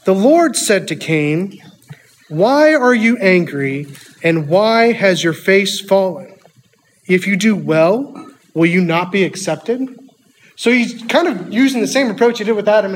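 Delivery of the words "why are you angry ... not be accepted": solemn scripture reading. Acted. Reading God's words to Cain.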